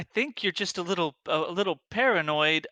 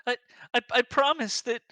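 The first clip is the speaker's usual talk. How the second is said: Nadia pleading; 6 semitones higher than usual. Acted. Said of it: "Nadia pleading in character".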